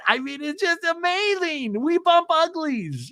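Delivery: high, giddy announcement to everyone; mock boyfriend bragging. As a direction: gush like someone with a first boyfriend. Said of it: "you're just telling everybody".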